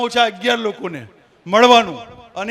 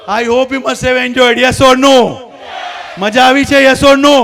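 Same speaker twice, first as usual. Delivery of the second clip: loud crowd-pumping questions; rousing shout; 7 dB louder, much slower than usual. Real, not acted. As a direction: demand a roaring answer from the hall. Rousing the audience for answers.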